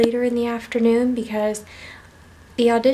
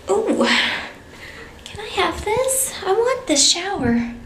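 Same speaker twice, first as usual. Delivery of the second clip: giddy delight; 8 semitones higher than usual. Real, not acted. Wants the shower.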